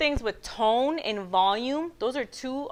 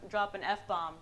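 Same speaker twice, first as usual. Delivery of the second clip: ordinary talk, nothing marked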